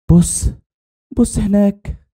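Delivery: high-pitched, frightened wife; terrified. Acted. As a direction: point out the danger in a high, scared voice. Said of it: "ما قدرتش ترد عليا من الرعب".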